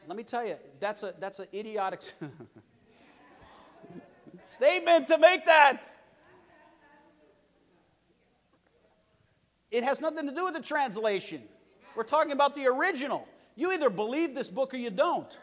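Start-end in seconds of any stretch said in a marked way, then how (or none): 4.4-15.4 high, loud pulpit cry; fiery preacher's declaration; preaching at full force. Acted. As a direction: drive it home to the congregation.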